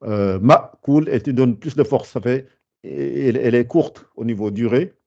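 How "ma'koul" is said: ma'koul with a crisp, short hamza stop; ma'koul, slow with a dry glottal stop; sharp hamza. Shown as a demonstration. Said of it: "faut qu'elle soit plus sec encore".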